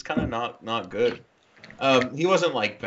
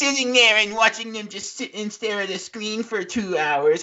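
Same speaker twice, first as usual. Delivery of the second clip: much higher, mocking voice, laughing through it; impression of Jake; 9 semitones higher than usual. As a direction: squeak out a gushing arthouse review. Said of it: "He totally nailed me".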